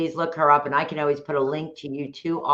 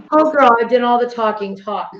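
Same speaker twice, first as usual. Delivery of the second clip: excited exclamation; 8 semitones higher, 8 dB louder than usual. Real, not acted. Laughing self-mocking exclamation.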